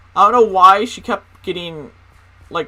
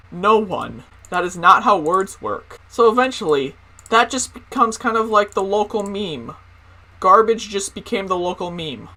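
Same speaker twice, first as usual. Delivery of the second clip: usual speaking voice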